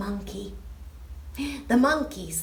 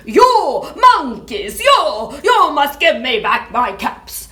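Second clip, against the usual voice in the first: loud, furious yell with high starts; furious shout; 3 semitones higher, 12 dB louder than usual. Acted. Peddler shouts at the monkeys in a fury. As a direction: bellow it up at the tree. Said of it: "stamped both his feet and shouted".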